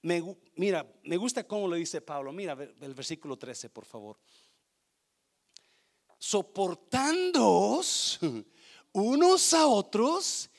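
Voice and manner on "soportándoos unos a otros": slow, drawn-out loud verse reading; solemn scripture reading. Acted. Preacher reading Colossians to church, slow solemn delivery. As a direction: read the verse slowly and weightily.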